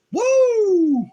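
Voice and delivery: very high, loud party whoop; screaming woo. Pretend. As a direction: whoop like a tourist on spring break. Pretends to party in Mexico.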